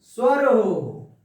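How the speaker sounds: स्वर हो said slow and loud; stressed key words. Shown as a demonstration. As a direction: lean hard and slow on स्वर हो. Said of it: "जिसकी अंतिम ध्वनि". (स्वर हो पर ज़ोर, धीरे-धीरे)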